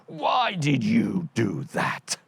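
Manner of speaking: slow, heavy voice of a puzzled giant. Acted. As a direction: drag it out like a dim giant. Giant accuses the other.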